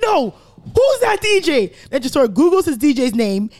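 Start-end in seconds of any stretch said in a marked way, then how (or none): 0.0-1.7 much higher, louder, slow question; eager friend. Retold or imitated. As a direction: ask it eagerly, drawn out. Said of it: "Tresor's like".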